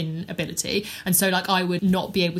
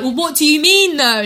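loud, high-pitched shouting at a screen; comic yell of disbelief; exasperated shout; 8 semitones higher, 8 dB louder than usual. Acted. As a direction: demand an answer, voice raised in mock outrage. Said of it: "I'm shouting at the screen".

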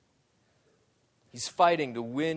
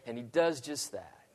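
usual speaking voice

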